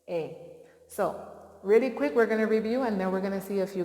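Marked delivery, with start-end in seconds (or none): 0.1-0.4 long A said as its name, ay; long A sound. Shown as a demonstration. Teacher modelling the long A sound for kids. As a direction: hold the A as a clear long ay. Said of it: "the sound of the long A is".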